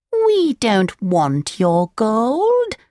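high, slow, drawn-out cat voice; storybook cat, high and lingering; coaxing cat. A narrator's reading. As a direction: stretch each word as a high-voiced cat. The cat feigns kindness.

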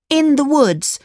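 put-on very high, guilty little boy; lying puppet voice. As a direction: squeak out the fib. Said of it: "Pinocchio said".

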